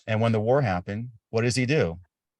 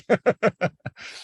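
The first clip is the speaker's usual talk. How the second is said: laugh; 12 semitones higher, 4 dB louder than usual. Real, not acted. Host laughs after military joke.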